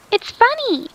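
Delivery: put-on squealing child. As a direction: squeal it like a delighted little girl. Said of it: "Sarah squealed".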